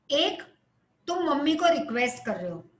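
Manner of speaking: ordinary talk, nothing marked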